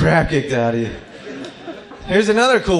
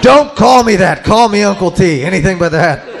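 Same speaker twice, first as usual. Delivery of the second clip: pleading uncle; 4 semitones higher, 9 dB louder than usual. Acted. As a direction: beg them outright, voice raised.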